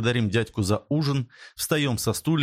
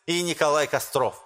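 high, lisping speech with sh for s; 6 semitones higher than usual. Acted. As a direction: lisp through a rousing public speech. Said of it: "По шепелявому голосу".

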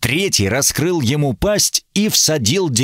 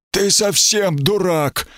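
mocking; 7 semitones higher, slower than usual. A narrator's reading. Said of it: "прозвучал насмешливый голос".

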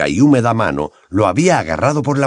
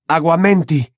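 shouted spell; 7 semitones higher than usual. Acted. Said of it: "gritó golpeando la copa".

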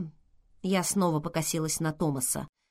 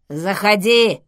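sudden loud blurt from the intercom; abrupt blurt; 2 semitones higher, 9 dB louder, slower than usual. A narrator's reading. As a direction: blurt it out loudly. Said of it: "вдруг брякнул голос". (вдруг громко брякнуть)

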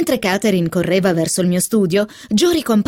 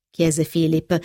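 plain reading voice

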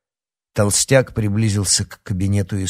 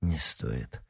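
tired and much softer; weary; 4 semitones lower, 10 dB quieter than usual. A narrator's reading. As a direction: let it drop out wearily. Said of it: "устало ответил Гордей".

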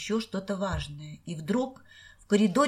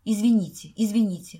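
ordinary delivery

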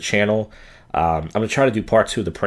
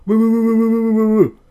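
high, loud, held "woo" noise; loud sing-song woo on one high note; imitated wooing; 6 dB louder than usual. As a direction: mimic a guy's taunting high wail. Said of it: "I hear a guy go".

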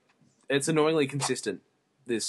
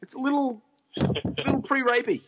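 high squeaky voice; 13 semitones higher than usual. Real, not acted. Joking, high voice again.

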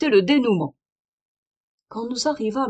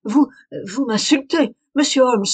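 louder, offended outburst; indignant and astonished; 4 semitones higher, 6 dB louder than usual. A narrator's reading. Lady Hilda to Holmes, caught out, indignant and louder. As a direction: throw the insult back at him, stunned. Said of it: "en proie à la fois à l'indignation et à l'étonnement".

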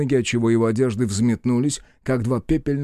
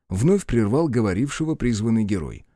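ordinary delivery

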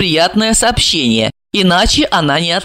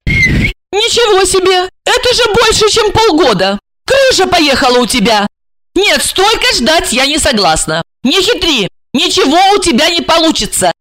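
high-pitched, scolding female voice; indignant ultimatum in a high voice; outraged woman; 10 semitones higher than usual. Acted. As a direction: refuse hotly, pitched high. Plays Regina, outraged.

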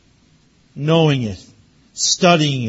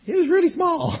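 laughing through the words, higher; 12 semitones higher, 4 dB quieter than usual. Real, not acted. Pastor seeing the tiny plane, laughing. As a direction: chuckle at how small the plane was.

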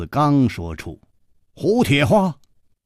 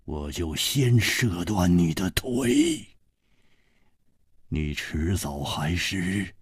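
slow, drawn-out warning; deliberate, softening menace; menacing threat; 5 semitones lower, 5 dB quieter, slower than usual. Acted. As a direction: sink low and slow to threaten. Slow threat to masked man.

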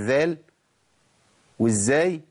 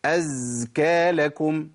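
أزكى dragged out far too long; overstretched; much slower than usual. Shown as a demonstration. Teacher shows the too-long stretch to avoid. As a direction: overdo the length of أزكى. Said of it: "ما أقولش".

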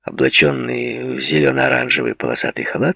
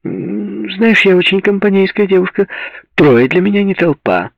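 high, louder flirty purr; femme fatale; 11 semitones higher, 5 dB louder than usual. A narrator's reading. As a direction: tempt him playfully. Seductive invitation on phone.

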